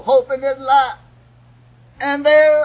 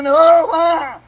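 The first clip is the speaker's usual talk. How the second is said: sudden loud cry, pitched up; preacher's shout; 3 semitones higher, 5 dB louder than usual. Real, not acted. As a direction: burst out at the congregation. Preacher's outcry mid-sermon.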